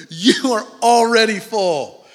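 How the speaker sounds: high, loud sarcastic exclamation. Acted. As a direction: throw it at them as Paul's sarcasm. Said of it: "that's what Paul's saying. He's like".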